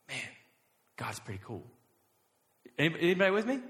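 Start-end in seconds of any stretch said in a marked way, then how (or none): 0.1-1.6 low, much softer aside; hushed awe. Acted. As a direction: say it quietly, as if to yourself. Acting out his quiet inner reaction.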